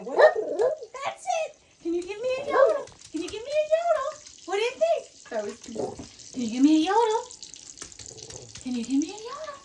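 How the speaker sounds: very high coaxing pet voice; begging the dog to yodel in a very high voice; dog talk. Real, not acted. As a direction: coax the yodel out with a squeaky high voice.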